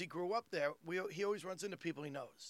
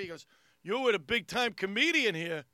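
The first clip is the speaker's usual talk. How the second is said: normal talking voice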